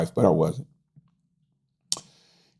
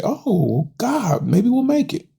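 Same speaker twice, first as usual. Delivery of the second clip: mock hopeful; 12 semitones higher, much slower than usual. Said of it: "that made me say".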